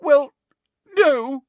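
high, loud sobbed words; sobbing. A narrator's reading. Girl admitting she doesn't know, sobbing. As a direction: sob each word separately. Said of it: "sobbed Hermione".

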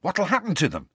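high-pitched, eager question. A narrator's reading. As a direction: ask it keenly, pitch lifted. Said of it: "said Ron eagerly".

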